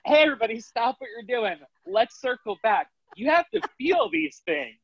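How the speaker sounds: high, drawn-out mock announcement; acted sudden realization. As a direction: re-enact a dawning "oops", calling everyone back in a high voice. Acts out her sudden realization to class.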